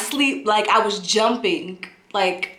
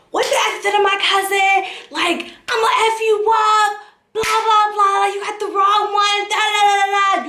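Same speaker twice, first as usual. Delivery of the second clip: high, rattled-off mock tirade; going off angrily; 10 semitones higher than usual. Pissed Brooklyn cousin on the phone, going off. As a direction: imitate a furious telling-off that runs into blah-blahs. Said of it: "going the f-, off".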